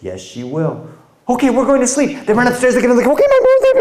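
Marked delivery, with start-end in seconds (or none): 1.3-3.7 put-on high, loud, panicky child voice; scared kids. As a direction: scramble to bed in fright. Scared kids rushing to bed.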